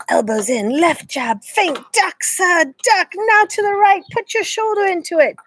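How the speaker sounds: high, brisk voiced coaching calls; the boxing book coaching him in his head. A narrator's reading. Boxing coach in his head calling out drills, brisk. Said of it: "turned the pages of the book in his head".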